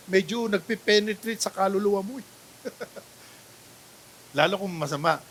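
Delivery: normal talking voice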